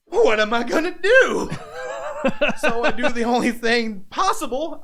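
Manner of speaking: high, loud, laughing cry of panic. Retold or imitated. Wrestler retelling his panic to the host, laughing loudly.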